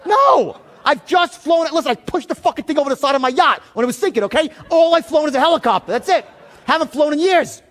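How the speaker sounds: high, loud disbelieving outburst; agitated. Retold or imitated. Customer denying he flew for the Navy, agitated. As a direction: protest loudly, pitched right up. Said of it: "I'm starting to get a little agitated".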